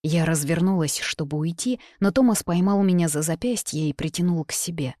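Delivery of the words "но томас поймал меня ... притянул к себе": ordinary delivery, nothing marked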